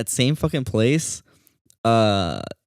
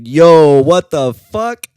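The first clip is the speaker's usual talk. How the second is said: loud, higher, slowed shocked yell; shocked shout; 6 semitones higher, 12 dB louder, much slower than usual. Retold or imitated. Man sees the toilet, loud slow shocked yell. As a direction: belt it out in disbelief, dragging the words. Seeing purple poop in toilet.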